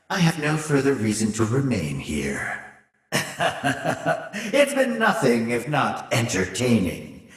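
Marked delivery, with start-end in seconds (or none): 3.1-4.5 soft, rippling sinister laugh; villainous chuckle. Acted. Villain about to leave the manor, amused chuckle.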